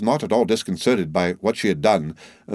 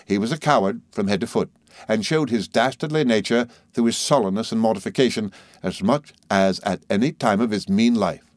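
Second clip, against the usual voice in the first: ordinary delivery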